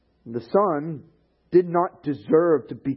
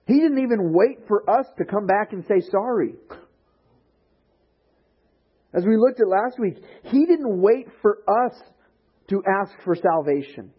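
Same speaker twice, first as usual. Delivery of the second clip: louder, higher preaching build; preaching at full force; 8 semitones higher, 5 dB louder than usual. Real, not acted. Pastor driving home grace to congregation, impassioned preaching. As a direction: press the point hard to the congregation.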